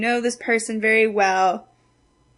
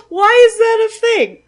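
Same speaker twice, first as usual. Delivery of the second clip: gasping, high disbelieving shout; shocked; 13 semitones higher, 11 dB louder than usual. Real, not acted. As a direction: gasp then yell in disbelief. Shocked outburst at mock wedding.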